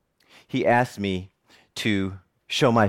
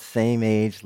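usual speaking voice

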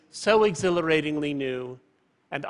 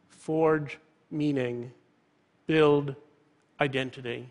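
FORGE, MEANING, BUILD, IDENTITY each set apart by pauses; slow down and weigh each word; slow, emphatic motto; slower than usual. Real, not acted. Closing motto repeated slowly for emphasis.

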